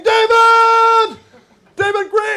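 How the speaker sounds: high sung-out holler of the name; calling out. Retold or imitated. Comic calling to a friend on a float. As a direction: wave and sing his name out loud. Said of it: "And I start waving".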